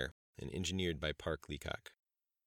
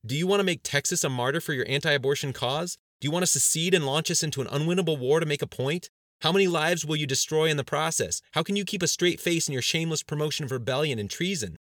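loud, high barrage of questions; indignant, accusing tirade; heated challenge; 9 semitones higher, 7 dB louder than usual. A narrator's reading. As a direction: berate them, voice raised throughout. Aide berates cabinet with questions.

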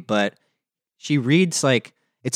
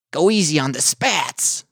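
higher-pitched Borscht Belt comic voice; Borscht Belt dummy; 5 semitones higher than usual. Acted. Co-host trying the dummy's line, Borscht Belt voice. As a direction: play a wisecracking Borscht Belt puppet. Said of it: "weird, like, Borscht Belt voice he does".

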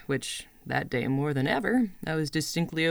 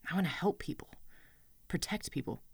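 whispered confession; 2 semitones higher, 8 dB quieter than usual. Acted. Confiding her dream quietly.

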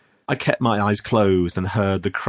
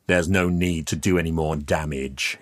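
plain reading voice

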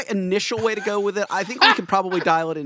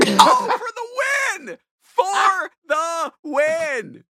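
much higher, slow drawn-out cheer; ecstatic cheer; 13 semitones higher, 4 dB louder, much slower than usual. Real, not acted. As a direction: cheer it like a winning goal. Cheering the pickup line.

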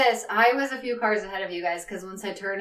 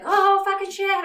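startled curse; 11 semitones higher, 3 dB louder than usual. Retold or imitated. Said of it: "I'll be like".

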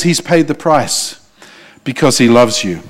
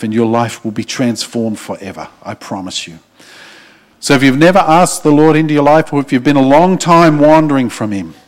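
altar call; 5 semitones lower than usual. Acted. Preacher inviting the congregation to pray, hushed and earnest. Leads the closing prayer invitation.

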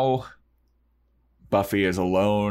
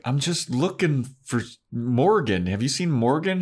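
higher, innocent schoolgirl voice; innocent Buffy; 7 semitones higher than usual. Retold or imitated. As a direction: pitch it up, all wide-eyed innocence. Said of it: "And she's like".

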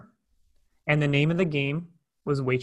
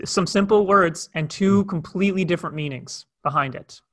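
usual speaking voice

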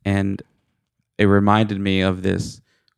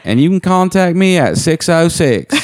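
put-on Kentucky caller accent; 8 semitones higher, 3 dB louder than usual. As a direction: call in like a rural Kentucky seller. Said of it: "everybody else is like".

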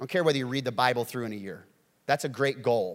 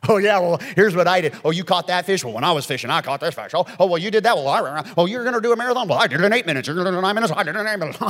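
loud, high hammed-up show-off voice; mock braggart; 4 semitones higher, 8 dB louder, faster than usual. Preacher mocking a prideful bragger, hammed-up boast. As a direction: puff up like a bragger one-upping everyone.

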